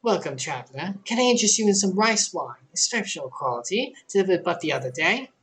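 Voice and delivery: lightly voiced welcoming trader; a shopkeeper greeting a traveler; friendly merchant. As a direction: offer rice wine like a shopkeeper.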